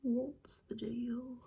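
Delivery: What's the Deal? whispered slowly in a bad British accent, long open vowels; horrible British accent. Shown as a demonstration. Teasing viewers with a bad British accent, whispered slowly. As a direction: drag out What's the Deal? like a clumsy Brit. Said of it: "You wanna hear my horrible British accent?".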